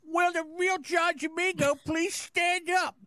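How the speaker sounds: high-pitched mocking impersonation; high, put-on imitation; Eric. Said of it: "let me get my Eric voice on".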